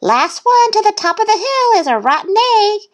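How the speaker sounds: high, loud little-duck dare; challenging shout. A narrator's reading. Thistle starting a race, high challenge.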